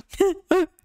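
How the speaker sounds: delighted laugh. Real, not acted. Charmed by learner.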